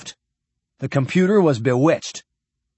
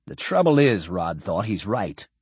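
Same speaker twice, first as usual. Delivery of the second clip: plain reading voice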